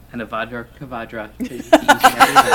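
hammed-up priestly blessing, laughing; mock blessing. Mock benediction to listeners.

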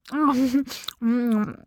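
muffled hum through food; wordless reply through a mouthful of bread; mouth-full mumble. A narrator's reading. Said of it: "said with a mouthful of bread".

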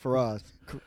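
normal talking voice

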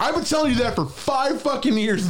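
very high-pitched vindicated complaint; acted exasperation. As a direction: protest as if finally proven right. Acts vindicated complaint to mom.

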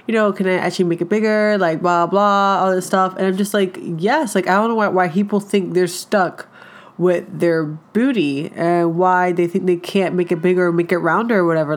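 usual speaking voice

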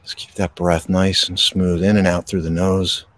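gentle breathing-exercise guidance; guided meditation. Acted. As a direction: ease them into stillness, unhurried.

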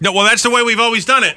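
high, dismissive brush-off. Shown as a demonstration. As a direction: wave it off dismissively. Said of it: "They always say it like this".

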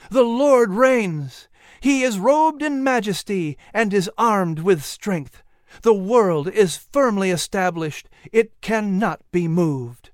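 slow, solemn psalm quote; psalm recitation. Acted. Boy quotes the psalm to God, solemn and slow. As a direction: proclaim it upward like a preacher. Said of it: "As he quoted the psalm".

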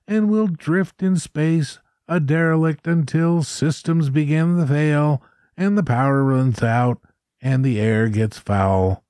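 a nervous chaplain lightly voiced, begging; nasal quavering voice. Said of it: "a nasally voice said over the annunciator".

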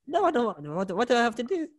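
high mock-scared volunteer voice; reluctant volunteer. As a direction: squeal like a nervous audience member. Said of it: "I'm getting in character".

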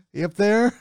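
high, loud mock Yooper accent. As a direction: tease them in a Midwest Yooper accent. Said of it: "trying to go for a Uber accent".

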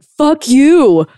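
loud, high angry snap; charged outburst. Shown as a demonstration. Triggered partner lashing out with a loud angry snap. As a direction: spit it out, full of charge. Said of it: "When you're communicating with energy".

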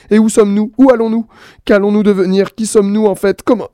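suddenly anxious. Acted. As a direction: fret in mounting panic. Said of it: "soudain inquiet".

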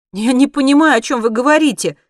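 high, loud protest; exclaiming. A narrator's reading. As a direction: cry out that you're lost. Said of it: "воскликнула Монти". (громкое восклицание)

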